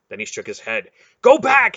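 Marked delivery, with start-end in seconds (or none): 1.2-1.8 very high, loud bark; barked order. Acted. Relg barking at the group to turn back, loud. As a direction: bark it like an alarm. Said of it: "he barked".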